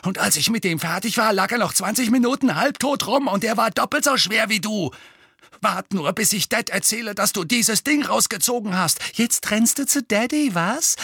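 high, boastful, threatening rant; heated bully. A narrator's reading. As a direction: brag and threaten without letting up. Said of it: "fauchte Dudley".

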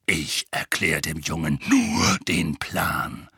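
low, slow growl; growling Moody. A narrator's reading. Moody growls back at Tonks, low and slow. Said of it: "grollte Moody".

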